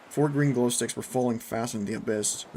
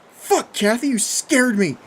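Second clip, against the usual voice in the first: loud, high, startled yell; screaming back; 12 semitones higher, 11 dB louder, slower than usual. A narrator's reading. Jeff to Kathy who scared him, screaming back. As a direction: yell back at her, rattled. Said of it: "Jeff screamed back".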